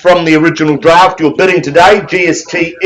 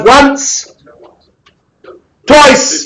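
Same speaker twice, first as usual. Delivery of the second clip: pitched high, drawn out auction call; calling once, twice; 8 semitones higher than usual. Real, not acted. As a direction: ring it out to the room like the final call.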